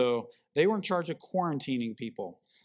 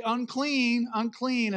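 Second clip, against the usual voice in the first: higher call of "Unclean" that falls at the end; calling out a warning; 7 semitones higher, slower than usual. Retold or imitated. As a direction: cry out to keep people back. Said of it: "or you say".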